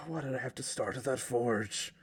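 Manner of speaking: despairing. Acted. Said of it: "choking up".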